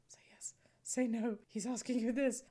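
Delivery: urgent stage whisper, retold; imitating a coaching whisper. Husband prompting his wife about his dad's words, imitated whisper. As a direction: whisper the answers like a secret prompter.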